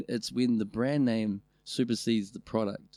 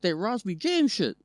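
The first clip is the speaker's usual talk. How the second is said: mock outraged; 8 semitones higher, 5 dB louder than usual. Mock outrage at fancy food.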